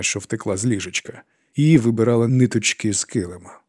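ordinary delivery

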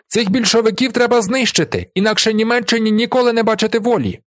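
high-pitched memorised slogan; rote patter. A narrator's reading. Fourth boy reciting a learned phrase, high rattled-off patter. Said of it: "скоромовкою вимовляє четвертий, явно завчену фразу".

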